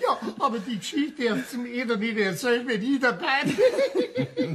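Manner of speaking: high, giggling and slowed by laughter; laughing so hard it nearly gives the game away. Acted. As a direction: fight back the giggles. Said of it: "Ich muss so lachen".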